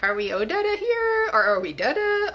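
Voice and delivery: high-pitched, playful mock of an undecided accent; wavering character accent. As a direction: voice the accent's confusion, starting high. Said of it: "why my accent was like".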